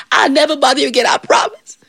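high, strained pleading; desperate promise. Acted. Devil stuck in bush swears to John, desperate.

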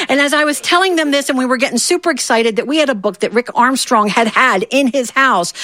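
ordinary talk

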